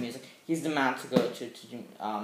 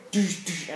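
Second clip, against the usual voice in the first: higher "doosh" blows; imitated punch sounds; 4 semitones higher than usual.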